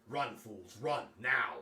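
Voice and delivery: rising to a loud shout on now; urgent command. Acted. Man to the freed prisoners, urgent shouted order. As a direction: drive them out with a call.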